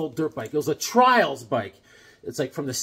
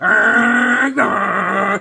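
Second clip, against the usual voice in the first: louder growling motor noise; engine revving; 5 semitones higher, 9 dB louder than usual. Retold or imitated. Teen on the old dirt bike, imitating engine revs. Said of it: "I'm like".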